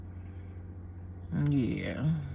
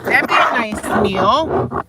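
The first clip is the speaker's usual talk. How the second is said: louder, slower, longing; excited; 5 semitones higher, 9 dB louder, slower than usual. Pretend. Dreaming about a big breakfast, loud and slow. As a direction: savour it out loud.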